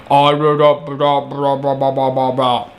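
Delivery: mock robotic vibrating drone; blah-blah it like a distorted robot; menacing robot voice. Mocking the Flash's vibrated voice, robotic drone. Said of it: "sounds like this menacing robot".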